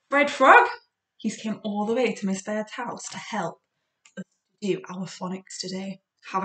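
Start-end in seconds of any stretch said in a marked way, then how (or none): 0.1-0.7 excited gasp. Acted. Big gasp before puppet name.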